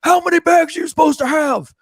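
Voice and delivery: high-pitched drill sergeant yell. Retold or imitated. Marine drill sergeant questioning the recruits, yelling. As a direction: demand an answer from the whole group. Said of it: "he starts yelling".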